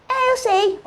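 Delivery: very high, mocking outburst; crazy man imitation. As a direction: mimic a crazy man cutting in. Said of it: "Olha como é louco".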